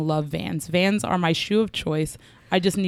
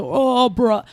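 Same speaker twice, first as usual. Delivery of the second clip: mock surfer bro, slightly raised; lightly put-on surfer voice; surfer type; 4 semitones higher, 3 dB louder than usual. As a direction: imitate a movie surfer dude with disdain. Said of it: "I cannot stand the".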